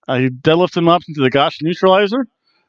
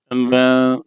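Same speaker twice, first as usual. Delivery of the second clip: usual speaking voice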